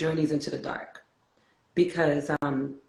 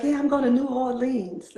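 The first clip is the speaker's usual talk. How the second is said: put-on "New Orleans" in a higher, New York accent; 7 semitones higher than usual. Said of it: "And so I was like".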